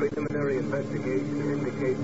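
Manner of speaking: clipped, slow dispatch read; telegram read. Acted. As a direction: read it like a decoded cable. Reading coded telegram message.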